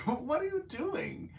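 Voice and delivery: high, slow, incredulous question. Retold or imitated. To the man on the phone in the theater, high slow disbelief. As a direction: ask it in disbelief. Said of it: "and I'm like".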